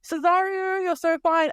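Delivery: hammed-up lovestruck Olivia; gushing excitement. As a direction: Olivia spotting her crush, high giddy gushing. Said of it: "and Olivia's like".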